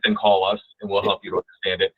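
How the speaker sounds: usual speaking voice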